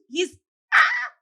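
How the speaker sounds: real exasperated shout; exasperated yell. Real, not acted. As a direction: blurt it out, fed up. Exasperated outburst about the president.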